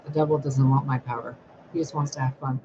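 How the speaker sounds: usual speaking voice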